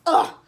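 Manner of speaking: loud, exaggerated ugh of disgust. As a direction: spit out a disgusted groan. Client's disgusted reaction, acted.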